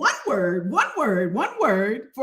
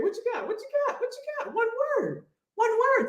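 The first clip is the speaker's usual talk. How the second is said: playful coaxing; 10 semitones higher, 5 dB quieter, faster than usual. Acted. Coaxing viewer for word.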